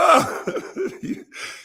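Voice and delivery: high, breathless wheezing laugh. Real, not acted. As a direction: let a silent wheeze of laughter out. Laugh after judge line.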